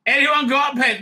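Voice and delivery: calling out. Retold or imitated. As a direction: call out across the room a second time. Calling out for a pen in crowd.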